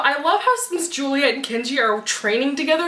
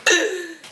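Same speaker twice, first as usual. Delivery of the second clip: mock gasp; 6 semitones higher than usual. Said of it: "they're like".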